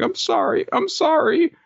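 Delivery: very high, squeaky apology; Nobuteru. Acted. Creature races off apologizing, high squeal. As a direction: apologize in a tiny cartoon voice. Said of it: "uh, he's like".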